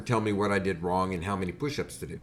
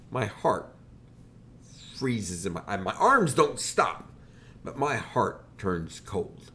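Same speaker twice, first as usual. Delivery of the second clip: normal talking voice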